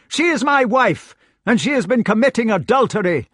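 high, loud accusation; outraged knight. A narrator's reading. Knight denounces his wife to Lancelot, outraged.

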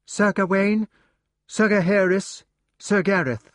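high, ringing announcement of each name; page announcing. Acted. Page announcing the knights to the room, high and ringing. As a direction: call each knight in like a herald at the door.

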